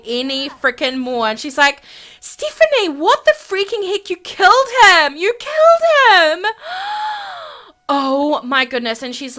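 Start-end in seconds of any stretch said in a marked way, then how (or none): 2.2-6.5 high, rising to loud cries; horrified shriek. Acted. Whitney confronting Stephanie over the killing, horrified. 6.5-7.6 high, drawn-in theatrical gasp; horrified gasp. Acted. Whitney realising he was killed, horrified gasp. Horror at the killing.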